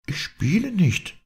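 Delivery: high, slow, lightly trembling protest. A narrator's reading. Young woman denying she toys with him, voice lightly trembling. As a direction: draw it out high, a touch of a tremble. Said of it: "ihre Stimme zitterte".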